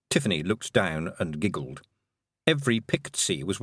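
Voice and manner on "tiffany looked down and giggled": ordinary delivery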